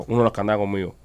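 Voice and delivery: ordinary delivery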